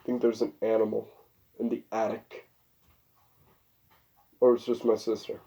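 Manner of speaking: hushed aside to the listener; quiet aside. Real, not acted.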